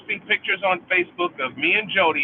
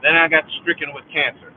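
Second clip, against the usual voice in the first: normal talking voice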